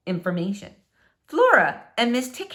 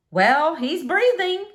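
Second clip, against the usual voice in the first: slow, higher, louder neighbor voice; drawn-out relieved neighbor; slow voiced Missus Tickham; neighbor's remark; 7 semitones higher, 4 dB louder, slower than usual. Missus Tickham, squirrel revived.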